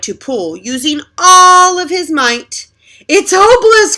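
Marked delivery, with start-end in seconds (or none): 3.1-4.0 high, loud wail; despairing cry. Acted. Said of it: "cried Moose".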